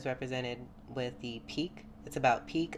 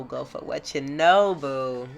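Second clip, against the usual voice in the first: cheering; 10 dB louder, slower than usual. Real, not acted. Cheering viewer on.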